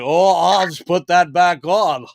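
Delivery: slow, lightly voiced bumbling android; drawn-out mock android voice; cheap android character. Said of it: "he's like".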